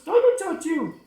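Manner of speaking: very high, loud puppet voice; high-pitched puppet chatter; puppet granny. Pretend. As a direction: squeal it out as the puppet. Puppet talking to presenter.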